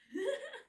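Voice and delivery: quick high laugh. Real, not acted. Laughs at own aggressive outburst.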